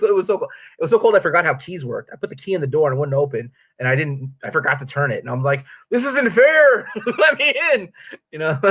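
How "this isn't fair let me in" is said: high plea through laughter; laughing, high-pitched retold pleading; mock protest. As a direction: whine at a locked door, hammed up and laughing. Acts out pleading at locked door.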